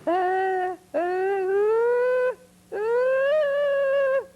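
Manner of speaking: very high, loud Tarzan yell; jungle yell. Retold or imitated. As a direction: swing through the trees yelling. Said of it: "George of the Jungle".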